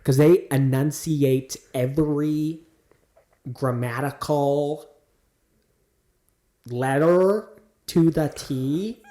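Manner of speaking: slow, drawn-out Californian over-enunciation; California accent. Acted. Explaining why the accent is hard, drawled mock Californian accent. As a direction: stretch every syllable like a mock Californian.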